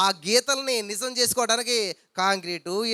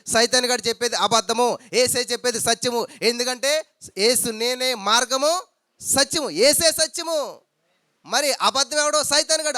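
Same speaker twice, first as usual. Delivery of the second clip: loud, slow, emphatic sermon voice; preacher hammering truth against lies, loud; fiery preaching; 3 semitones higher, 5 dB louder than usual. Acted. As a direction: drive each truth home to the congregation. Preaching at full force.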